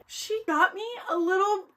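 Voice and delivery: almost crying over a gift; happy tears. Real, not acted. Vlogger touched by fan's bear gift, teary voice.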